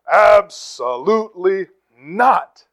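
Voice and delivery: slow, loud, final mother's no; mom's flat refusal. Retold or imitated. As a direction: lay down the law like a stern mom. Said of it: "She would say".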